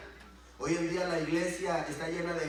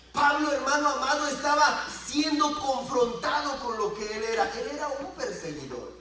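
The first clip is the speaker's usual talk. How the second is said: loud, raised preacher's voice; forceful preaching; 8 semitones higher, 7 dB louder than usual. Real, not acted. Preacher telling of Paul confronted, forceful preaching. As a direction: drive it out to the congregation.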